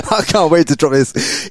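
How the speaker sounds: laughing excitedly. Real, not acted. Hyped retelling, laughing.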